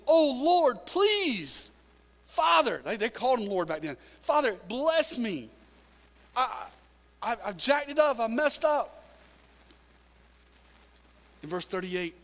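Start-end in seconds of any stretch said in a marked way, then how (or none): 0.1-2.8 high, loud sobbing plea; Esau crying. Retold or imitated. Esau begs his father for a blessing, crying high and loud. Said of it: "I can just hear Esau crying, weeping". 4.2-9.0 high, loud sobbing plea; Esau crying. Retold or imitated. Esau begs his father for a blessing, crying high and loud. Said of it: "I can just hear Esau crying, weeping".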